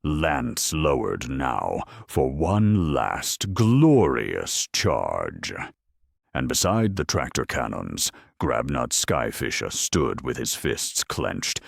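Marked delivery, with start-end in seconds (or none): none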